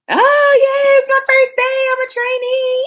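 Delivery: very high, loud, gushing trainee voice; hammed-up bubbly newbie; mock excited trainee. Mocking an overeager trainee on first day, squealing excitedly. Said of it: "excited and happy and like".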